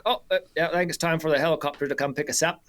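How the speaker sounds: put-on high, quick New Zealand accent. Guide calling time on the hunt, New Zealand accent. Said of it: "pretty good New Zealand accent".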